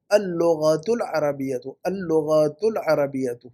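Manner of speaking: اللغۃ slowly as "al-lo-ghaa-tu", single gh; correct pronunciation. Shown as a demonstration. Teacher models the phrase for student, correct pronunciation. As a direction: draw out اللغۃ العربیۃ syllable by syllable. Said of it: "لغہ نہیں ہے وہ".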